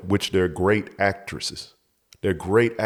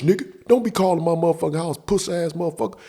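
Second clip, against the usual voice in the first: high-pitched, fast phone threat; retold angry phone rant; angry caller; 8 semitones higher, faster than usual. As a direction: cuss him out fast and high. Angry caller before hanging up.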